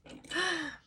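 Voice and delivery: sharp gasp of shock; quick startled intake of breath. Retold or imitated. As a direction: gasp as if hit by the headline. Reacting to the news.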